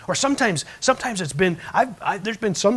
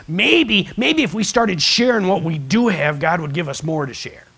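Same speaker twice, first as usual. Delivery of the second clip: louder, higher, slower maybe if we started; preaching loud; 3 semitones higher, 8 dB louder, slower than usual. Real, not acted. Pastor urging the church to share, loud and rising. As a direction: build it up and proclaim.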